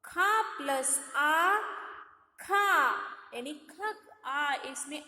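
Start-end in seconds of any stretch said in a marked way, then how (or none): 0.0-2.6 slow demo. Shown as a demonstration. Teacher showing children how ख is built, slowly. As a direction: say ख plus अ very slowly, each part apart. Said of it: "ख देखो बच्चों".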